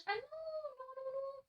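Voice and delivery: high-pitched dismayed no no no; startled no. Real, not acted. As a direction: caught out, squeak the nos. Realises she forgot a page. (non non aigus de surprise)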